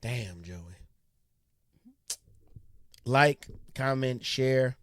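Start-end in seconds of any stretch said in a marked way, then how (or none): 3.0-4.8 slow, loud sign-off; outro call-out. Acted. Host signing off the video, slow loud call-out. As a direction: announce it to the viewers.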